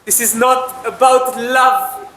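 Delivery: high, slow, drawn-out protest; exasperated pleading. Acted. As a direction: plead with her to stop dodging. Repeats the protest, insisting.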